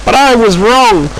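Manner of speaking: high-pitched, forceful telling-off; angry scolding. Acted. Controller scolding Stanley for derailing, angry. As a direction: demand an explanation, cross and high.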